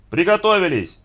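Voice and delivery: high, loud standby call; big director's yell; shouted command. A narrator's reading. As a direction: shout to the whole set. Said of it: "закричал Яков Борисович".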